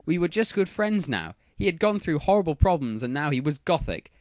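ordinary delivery, nothing marked

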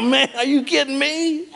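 high cartoon dog, disbelieving; dog complaining about being taken; talking dog. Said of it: "the dog went".

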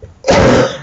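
sharp loud cough. Real, not acted. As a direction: hack out a cough. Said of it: "Excuse me".

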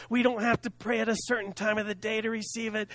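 usual speaking voice

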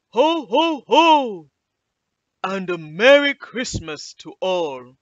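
loud, booming, drawn-out Santa voice; big festive Santa holler; Santa's farewell call. A narrator's reading. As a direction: call out to everyone as you fly off. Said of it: "he said".

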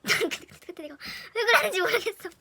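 embarrassed cracking up; real laughter at herself; laughing. Real, not acted. Laughing at own past.

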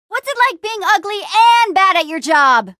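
high, punchy mockery falling at the end; taunting jeer. Hero insulting a monster, taunting shout. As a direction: jeer at the enemy across the fight.

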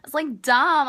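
loud, high scoff; loud exasperation. Real, not acted. Ranting about the old man, a loud scoff. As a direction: spit out your disgust loudly.

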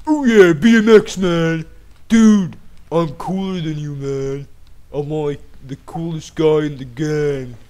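lightly voiced cocky teen; cool dude voice. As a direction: swagger like the coolest guy. Cool-kid character voice.

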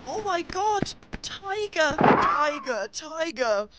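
high panicked cry, the tiger is coming; terrified fox spots a tiger; frightened cry. Acted. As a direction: yelp in fright, voice way up.

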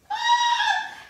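very high, loud gasp; big gasp of wonder. Acted. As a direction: gasp in delight. Caps about to fly.

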